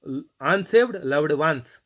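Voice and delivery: normal talking voice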